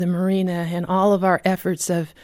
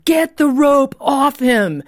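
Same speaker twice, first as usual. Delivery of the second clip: slow, high, louder command; commanding shout; 6 semitones higher, 4 dB louder, slower than usual. Retold or imitated. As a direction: hammer out each word like an order from above. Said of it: "I could hear the Holy Spirit shouting".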